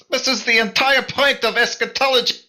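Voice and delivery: high, loud disbelief, climbing to a yell; outraged exclamation. Acted. Player's character tells friend of doomsday plot, incredulous shout. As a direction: build from protest to high-pitched outcry.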